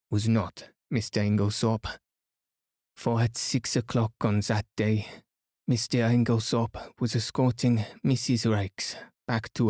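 put-on French-accented detective, declamatory; Poirot. Poirot declaring the suspect's alibi to everyone, formally accented.

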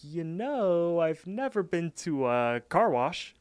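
high, drawn-out musing; retold coy hint. Cart angling for a car wash, coy musing. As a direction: muse slowly in a lifted voice, fishing for a favor.

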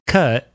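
"Kurt" said like "cut"; English-sounding "Kurt" with no R. Retold or imitated. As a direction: drop the R in "Kurt". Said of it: "sounded like I was English".

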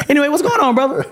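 high Michael voice. Retold or imitated. [name] asking what's going on, high.

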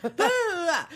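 high, swooping mock grumbling; hammed-up blah-blah with attitude; mock snotty tone. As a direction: imitate him whining dismissively. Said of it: "You got such a tone".

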